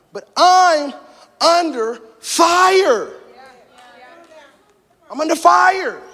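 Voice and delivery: high, drawn-out cry to God; tormented preacher's outcry; anguished cry. Retold or imitated. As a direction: wail it up high with long stressed words. Anguished cry to God, under fire.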